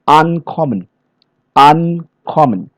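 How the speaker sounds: "uncommon" with a long, leaning first syllable; first-syllable stress. Shown as a demonstration. Trainer giving examples of German word stress, first-syllable stress. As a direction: draw out the start of "uncommon", let the rest fall. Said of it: "first syllable of a German word bears the stress".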